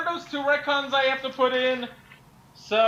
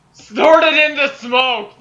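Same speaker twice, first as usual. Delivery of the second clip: slow, louder declaration; emphatic retcon; 3 semitones higher, 8 dB louder, much slower than usual. Real, not acted. Friend declaring a story retcon, slow and loud. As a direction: proclaim the fix. Improvised fix announced.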